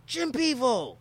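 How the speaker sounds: high, loud bar-room holler; imitated yelling. Man in a Krakow bar calls at a baffled visitor, yelling. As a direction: bellow it like a rowdy foreign drinker. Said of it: "Motherfuckers yelling shit at you".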